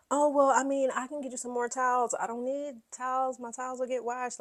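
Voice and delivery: high, eager mock Kyle offering towels; imitating Kyle. Host imitating Kyle offering towels, eager high. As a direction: pitch up and gush. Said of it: "And Kyle's like".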